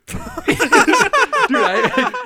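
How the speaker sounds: high, loud burst of laughter; helpless laughter breaking into the words; laughing hard. Real, not acted. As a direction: crack up so hard it squeaks. Laughing at her text.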